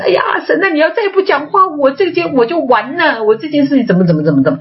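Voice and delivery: high, pleading wail to God; retold crying prayer. Woman in crisis begging God to speak, high tearful plea. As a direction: replay her tearful cry to God. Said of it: "我开始哭了".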